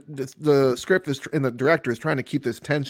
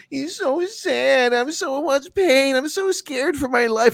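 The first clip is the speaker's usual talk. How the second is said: high, slow, hammed-up whimper; mock sob-story voice of the ghost; mock whimpering victim; 12 semitones higher, slower than usual. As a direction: whine like a fake victim to mock him. Mocking the crying ghost.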